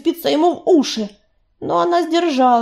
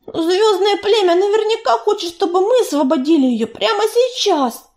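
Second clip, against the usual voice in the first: stubborn insistence; 7 semitones higher than usual. A narrator's reading. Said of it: "упорно повторила она".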